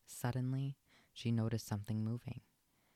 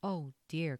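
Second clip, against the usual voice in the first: dismayed cry; 6 semitones higher, 5 dB louder than usual. A narrator's reading. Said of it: "cried Lady".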